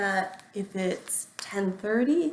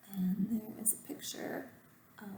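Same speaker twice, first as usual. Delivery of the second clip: aside whisper; 9 dB quieter than usual. Real, not acted.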